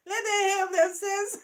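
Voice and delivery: laughing at the situation; laughing loudly. Real, not acted. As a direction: blurt it through laughter. Laughing, loud remark.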